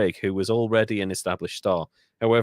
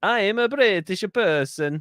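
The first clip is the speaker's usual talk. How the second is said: put-on high, over-the-top British accent; fake British accent; 9 semitones higher than usual. Host mocking bad British imitation, high fake British accent. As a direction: announce it in a hammed-up British voice.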